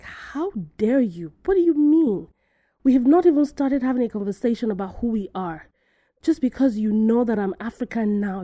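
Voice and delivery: voicing an offended woman's outrage; angry retort. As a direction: throw it back at him, affronted. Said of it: "She was so angry".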